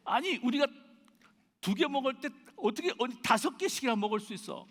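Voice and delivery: put-on high, loud, aggrieved kid-sister protest; tearful, resentful. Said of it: "울음 섞인, 원망 섞인, 억울하다고 하는".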